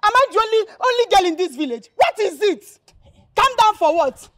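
high, loud, angry challenge; indignant shouting. Acted. Girl told to calm down, indignant shouting.

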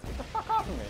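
high, frantic shout; panicked shout. Real, not acted. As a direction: shriek it as they swarm you. Attacked by imps.

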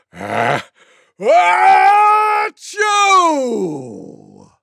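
long, high sneeze falling away; enormous mock sneeze. A narrator's reading. Tiger giving the loudest sneeze imaginable, enormous mock sneeze. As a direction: breathe in, then roar out the achoo. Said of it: "gave the loudest sneeze you could ever imagine".

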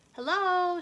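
high, loud hello out the window; calling out. Acted. Hen calling hello out the window, loud. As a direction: call to the neighbours. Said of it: "she said out the window".

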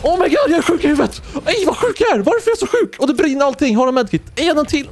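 high-pitched, thrilled squeal; excited squeal. Real, not acted. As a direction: squeal with delight at your own play. Streamer thrilled after a wild play. (hög, överlycklig glädjetjut)

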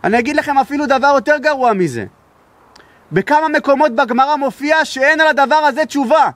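high, impassioned pulpit voice, pressing each phrase; preaching at full force. Acted. Rabbi warns the congregation in a high, impassioned preaching voice. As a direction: rise up and preach it to the hall. Preacher drives point home.

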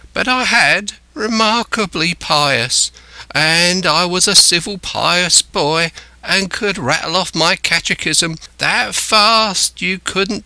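high, slow, drawn-out castaway voice; pious castaway, earnest. A narrator's reading. Castaway telling Jim of his piety, earnest.

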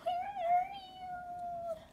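very high, slow, soft squeak; a tiny frightened bat calling; slow high squeaky plea; squeaky baby bat. A narrator's reading. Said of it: "squeaked Stellaluna".

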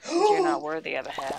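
high mock-haughty voice; haughty teasing. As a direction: look down your nose.

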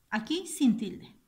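usual speaking voice

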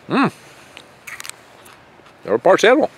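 normal talking voice

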